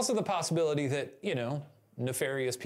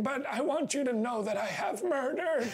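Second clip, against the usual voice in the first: dying man voice; 10 semitones higher than usual. Retold or imitated. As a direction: ham up a dying confession. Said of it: "with their dying words be like".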